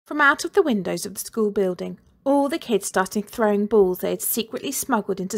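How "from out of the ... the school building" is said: ordinary delivery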